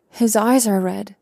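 plain reading voice

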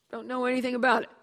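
high, loud, rushed denial; hasty denial. Acted. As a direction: blurt it out fast to dodge the blame. Edison denies breaking the chair.

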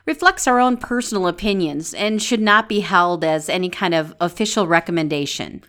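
calm disclaimer read over music; smooth polished announcement. Acted. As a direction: read it like a formal notice. Reads a disclaimer.

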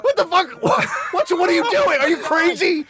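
high, stammering mock disbelief; baffled onlooker. Comedian playing the woman watching his audition, mock bafflement. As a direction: sputter it as the stunned watcher. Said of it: "imagine from her end watching that".

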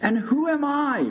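high, hollered question; top-of-lungs shout. A narrator's reading. Big Bird demands patient identify him, shouting. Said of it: "shouted at the top of his lungs".

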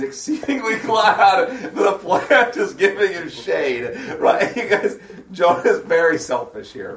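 loud, high, laughing through the words; slow, loud emphasis breaking into laughter; laughing incredulity. Real, not acted. Teacher pointing out Jonah's selfishness to the group, laughing incredulously.

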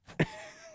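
short high burst of laughter; laughing at the mother. Real, not acted. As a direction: laugh at the absurd line. Laughing at the story.

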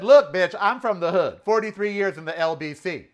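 loud, high street tough talk. Retold or imitated. Telling off the guard, loud and tough. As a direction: square up and threaten. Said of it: "I said".

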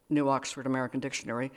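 ordinary delivery, nothing marked

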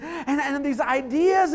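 ordinary delivery

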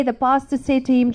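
ordinary delivery

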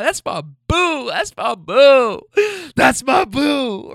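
high, loud, drawn-out whoop of pride; slow, elated mock hollering; proud hyped cheering. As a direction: cheer on your girlfriend, stretching the words. Cheering on girlfriend, retold.

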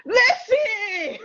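high burst of laughter on listen; cracking up mid word; laughing outburst. Real, not acted. Laughing at her own practiced accent.

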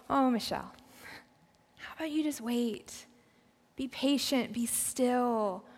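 soft, hushed voice of God, soothing; gentle whisper. Acted. God quieting an impatient believer, gentle near-whisper. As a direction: hush them tenderly, slowing them right down. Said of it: "I hear God whisper and say".

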